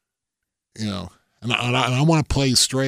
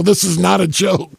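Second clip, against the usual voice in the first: mock insistent, louder and higher; loud insistence; 8 semitones higher, 7 dB louder than usual. Barkley insisting to his golf buddies, loud and raised. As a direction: hammer home that it's no joke.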